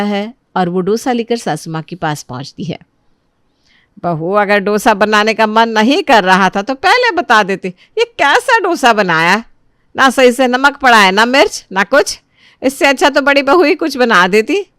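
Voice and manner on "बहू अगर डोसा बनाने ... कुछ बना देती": loud, indignant complaining; cross and fault-finding; scolding mother-in-law. Acted. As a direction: tell off a daughter-in-law, punching the words. Mother-in-law scolds over the dosa.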